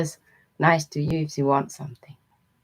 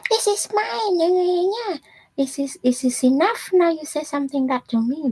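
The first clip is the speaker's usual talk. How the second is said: mocking angry neighbor; 12 semitones higher than usual. Imitating the neighbor yelling about her parking, high and indignant. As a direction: mimic her outraged protest, pitched way up. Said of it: "That's what she said".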